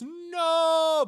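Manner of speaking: long, high, loud cry of protest; hammed-up movie-hero wail; drawn-out mock scream. Hero vanishing from reality, long mock "no" scream. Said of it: "And then Tim Allen goes".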